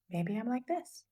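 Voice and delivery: quiet, tentative musing with a lift at the end; whispering. Real, not acted. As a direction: say it in a near-whisper. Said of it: "I don't know why I'm whispering".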